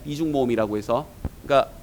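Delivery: ordinary talk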